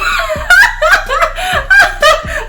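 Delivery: shrieking laugh at the memory; high burst of laughter. Real, not acted. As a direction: laugh at herself, high and free. Laughing after walking out.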